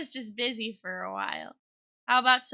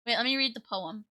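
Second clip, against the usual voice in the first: ordinary delivery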